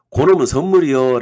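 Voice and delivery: loud, punched-out catchphrase; emphatic mnemonic. Shown as a demonstration. Presents a catchy memory phrase.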